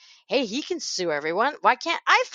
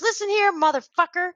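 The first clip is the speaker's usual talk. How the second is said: high-pitched mock tough warning; mock confrontational rant, pitched up; imitated angry rant; 8 semitones higher than usual. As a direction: act out his fed-up opening threat. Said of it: "he goes".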